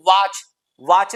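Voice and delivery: watch with a broad "aa", "vaach"; wrong pronunciation. Shown as a demonstration. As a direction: say वॉच as "vaach". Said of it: "वॉच नहीं". (वॉच को "वाच" जैसा खुले आ से)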